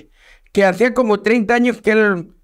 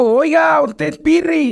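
lady voice; 7 semitones higher than usual. Retold or imitated. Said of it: "y me dijo".

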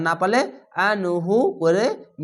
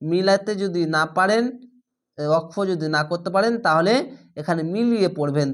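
normal talking voice

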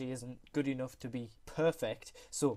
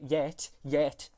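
yet pushed out louder, twice over; stress on yet; 6 dB louder than usual. Shown as a demonstration. Stressing yet while explaining speech recognition. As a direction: lean hard on yet, yet. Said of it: "emphasis on yet".

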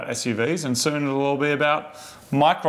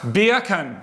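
beer can squeezed into Jamaican "bacon"; mock Jamaican accent; 6 semitones higher than usual. Host milking the pun at co-host, mock Jamaican accent.

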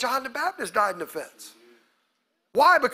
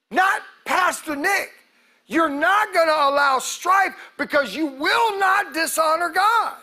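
preaching shout; 5 semitones higher than usual. Real, not acted. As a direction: drive it home at full voice. Preaching at full force.

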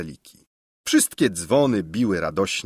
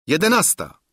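calling out the hour; 9 semitones higher, 3 dB louder than usual. A narrator's reading. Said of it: "zawołał".

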